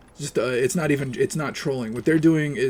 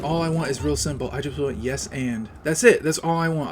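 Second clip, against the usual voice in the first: ordinary delivery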